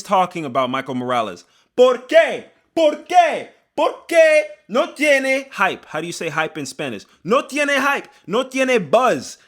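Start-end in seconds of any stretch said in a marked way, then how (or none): 1.8-5.8 high, loud, drawn-out ¿Por qué? in mock outrage; hammed-up Spanish lament; mock Spanish outcry. 7.2-9.4 high, loud, drawn-out ¿Por qué? in mock outrage; hammed-up Spanish lament; mock Spanish outcry.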